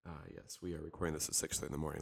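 low, quiet groggy morning voice; sleepy just-woke-up voice. Pretend. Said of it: "Let me get my morning voice out".

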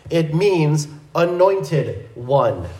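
ordinary talk

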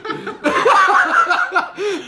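high, loud burst of laughter; real high-pitched cackling laugh; real laughing fit. Real, not acted. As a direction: crack up at your own story. Cracking up at own story.

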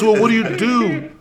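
high, loud mock challenge; challenging stranger. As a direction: imitate him, drawn out and loud. Retold challenge from the other guy.

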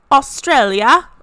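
a loud, high, hammed-up Australian twang on Australia; bad Australian accent. Telling viewers her friend is from Australia, in a bad Australian accent. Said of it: "that was not a good Australian accent".

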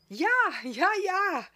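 high, loud cry of delight; jubilant, high-pitched cheering; enthusiastic shout. A narrator's reading. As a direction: shout it out, thrilled. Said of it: "rief Lichtina begeistert".

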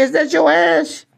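deadpan high-voiced punchline; high, slow mock question. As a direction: ask it slowly in a squeaky voice.

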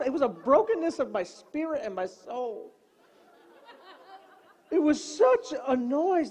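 ordinary talk

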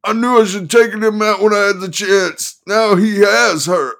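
high, loud manic lawyer; excited manic attorney. Acted. Attorney voiced, anguished.

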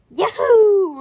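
loud, high whoop; excited yell. Acted. Boy cheering on the bus, a loud whoop. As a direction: whoop with joy. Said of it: "Rip yelled".

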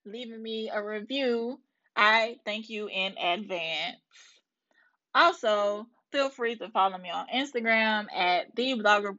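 podcast sign-off. Real, not acted. Host thanks listeners and asks them to subscribe, measured sign-off.

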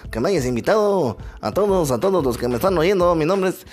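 lightly voiced country man from the sierra; rustic ranchero character. Don Chuy tells the host about his Valentine's gift in a country voice. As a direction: play a simple mountain man telling his woes.

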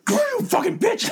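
high, slow, hammed-up screech of rage; mock furious yell. Piper punching her rival, mock furious yell. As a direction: scream it at her like you're throwing the punch. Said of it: "like, punch her, like".

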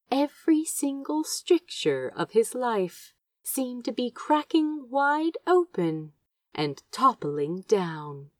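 usual speaking voice

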